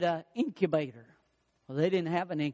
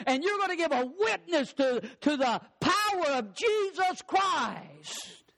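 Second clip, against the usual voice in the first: high, fervent preaching; 7 semitones higher than usual. Acted. Pastor tells the church God's purpose, fervent. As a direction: preach with urgency, pitched up.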